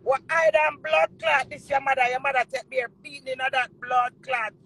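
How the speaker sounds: normal talking voice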